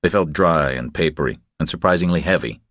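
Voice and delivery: plain reading voice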